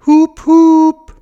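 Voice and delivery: high, loud imitated honk; high held toot; car horn. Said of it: "da hupt das Auto von hinten".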